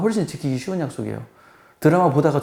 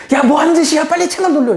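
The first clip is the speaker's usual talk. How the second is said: much higher and louder furious shout; angry mother's yell; 14 semitones higher, 6 dB louder than usual. Retold or imitated. Mother yelling at her son who switched the channel, furious. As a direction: scold the son for changing the channel.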